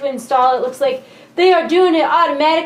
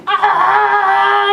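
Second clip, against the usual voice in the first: excited cry; 6 semitones higher, 5 dB louder than usual. Real, not acted. Excited outburst.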